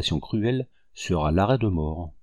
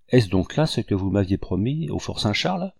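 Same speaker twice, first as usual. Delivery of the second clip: ordinary delivery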